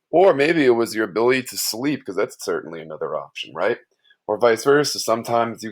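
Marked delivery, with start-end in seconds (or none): none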